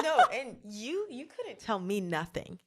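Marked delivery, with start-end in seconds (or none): 0.0-1.6 laughing it off; giggling protest. Real, not acted. Laughing with friend.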